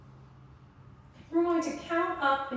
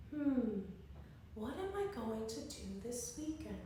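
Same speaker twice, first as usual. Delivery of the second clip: 1 thinking aloud; 2 semitones lower, 7 dB quieter than usual. Shown as a demonstration. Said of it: "I want you to think".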